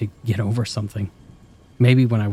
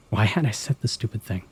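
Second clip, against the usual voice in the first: ordinary delivery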